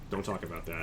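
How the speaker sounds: low, soft brush-off; hushed deflection. Acted. Dad shakes his head at a touchy question, hushed. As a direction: drop right down and close the subject.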